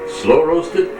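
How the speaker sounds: ordinary talk, nothing marked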